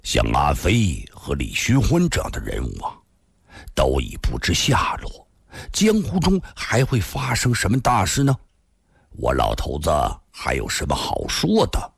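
put-on low, weary old storyteller; sighing resignation. Old storyteller lamenting vanished heroes, low and resigned. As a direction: lament it low, as if nothing is left. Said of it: "老头子长叹了口气".

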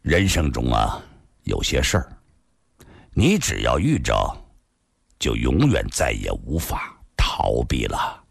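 low, heavy-hearted with long pauses; wistful, bitter resignation. A narrator's reading. Li Xunhuan on what life won't let one escape, wistfully. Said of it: "李寻欢笑得很心酸".

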